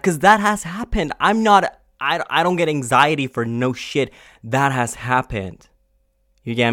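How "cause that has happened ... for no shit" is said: real angry rant; angry outburst. Real, not acted. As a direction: voice jumps high and loud in anger. Ranting about being mocked.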